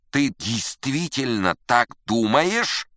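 high, loud, slow challenge; intense questioning. Acted. Musician pressing his friend on the praise, agitated. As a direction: demand the truth, stretching each word.

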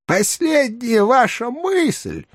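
slow, high, strange little flea voice; a tiny creature drawing it out slowly; tiny monster trying fierce. A narrator's reading. Master Flea interrupts Peregrinus. (крошечное существо, грозно и медленно)

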